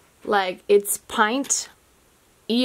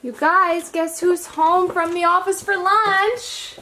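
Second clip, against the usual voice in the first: welcoming someone home; 8 semitones higher, slower than usual. Real, not acted. Husband arrives home.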